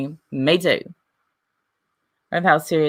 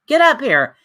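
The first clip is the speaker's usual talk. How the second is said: challenging call; 7 semitones higher, 4 dB louder than usual. Real, not acted. Challenging a chatter.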